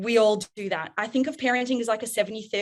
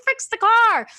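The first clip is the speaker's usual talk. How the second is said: much higher, louder demand; frantic yell; 13 semitones higher, 8 dB louder than usual. Retold or imitated. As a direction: shout it up the stairs at your husband. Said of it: "I'm like".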